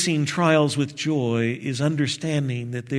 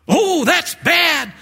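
high, slow, hammed-up dismay; dismayed customer; 14 semitones higher, 5 dB louder, slower than usual. Preacher plays the customer groaning, high and slow. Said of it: "customer's reply in sketch".

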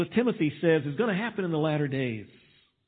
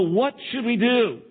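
higher, pleading questions of worried people; anxious people asking; 7 semitones higher than usual. Retold or imitated. Preacher voicing frightened people asking what to do, pleading. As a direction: voice the desperate crowd begging for answers. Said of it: "people are gonna wanna know".

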